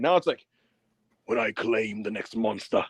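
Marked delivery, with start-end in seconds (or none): 1.2-2.8 Bronson Reed's Vegeta voice. Retold or imitated. Mocking Bronson Reed's new promo voice, low and menacing. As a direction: growl it like an anime villain. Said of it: "this fucking Vegeta voice".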